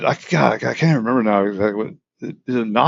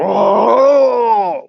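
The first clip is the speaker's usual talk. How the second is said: long, high-pitched imitated creature howl; imitated howling yell; 11 semitones higher, 4 dB louder than usual. Storyteller imitating the creature heard at night, high howling yell. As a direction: belt out a drawn-out howl, rising then falling. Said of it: "with a yell mixed in it".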